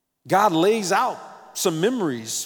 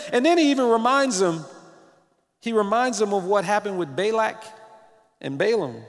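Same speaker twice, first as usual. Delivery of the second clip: normal talking voice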